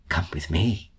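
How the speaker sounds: quiet tender mother; gentle invitation. A narrator's reading. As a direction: draw the child along tenderly. Mother leading her gently.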